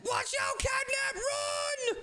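high shouted warning; urgent warning. Pretend. Boy warning Catnap to run from Poppy, a high shout. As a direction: yell at him to flee.

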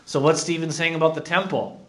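ordinary talk

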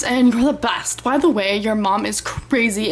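normal talking voice

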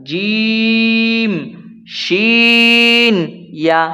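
জিম শিন ইয়া each held long; letter names drawn out. Shown as a demonstration. Teacher reciting the letters of the sixth point. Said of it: "তার সঙ্গে লাগায় উচ্চারিত হয়".

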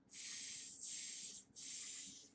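long unvoiced S hiss; snake hiss sound. Shown as a demonstration. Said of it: "letter sound drill".

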